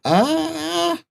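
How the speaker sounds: high, drawn-out squeaky creature; small trader's slow, high-pitched demand; little merchant naming price. Acted. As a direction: stall high, then slowly announce it. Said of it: "and he goes".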